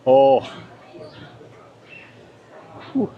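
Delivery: gasping through a brain freeze; pained brain freeze groan. Real, not acted. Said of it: "Oh, I'm getting a brain freeze".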